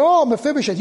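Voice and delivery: king David sneering at Mephibosheth's name; sarcastic. Retold or imitated. David greets Mephibosheth with sarcasm. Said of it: "hear the sarcasm come out of David".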